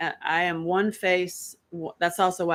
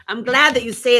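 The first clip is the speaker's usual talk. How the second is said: same words, now higher and louder; 5 semitones higher, 9 dB louder than usual. Shown as a demonstration. Answering the fan again, now loud and high. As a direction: say it out, bright and loud. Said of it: "I'm glad that you say these things".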